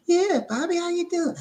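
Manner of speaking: high falsetto auntie; high-pitched auntie impersonation. Acted. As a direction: greet him in a put-on aunt voice. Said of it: "my auntie".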